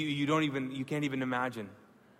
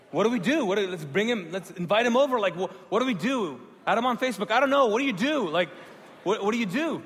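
frantic eager questions; 8 semitones higher, 8 dB louder than usual. Retold or imitated. Frantic questions about Jesus.

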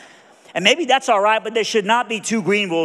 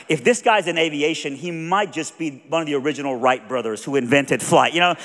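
ordinary talk

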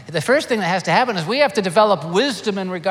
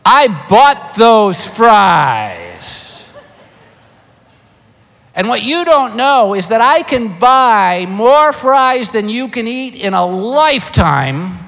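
slow, much louder, deliberate warning; mock stern grandpa; 5 semitones higher, 9 dB louder, much slower than usual. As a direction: lay down the law to the grandkids. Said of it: "and I says".

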